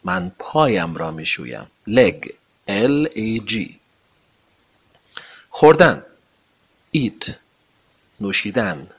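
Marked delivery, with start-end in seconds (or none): none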